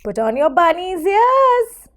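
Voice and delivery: ordinary delivery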